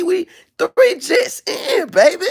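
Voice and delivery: high, loud, crying shout, slowed; hammed-up sobbing cry; mock wailing plea. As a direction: wail it like a desperate husband. Acted husband pleading with wife.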